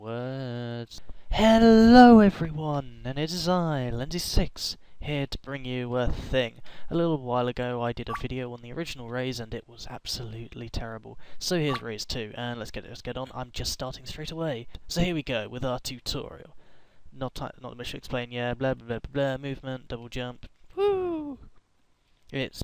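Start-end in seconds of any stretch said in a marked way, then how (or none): 1.3-4.8 big, much higher and louder hello; loud, high channel intro; hammed-up greeting. 20.8-21.4 high delighted whoop; quick high whoop; woo. Real, not acted. Double jump cheer.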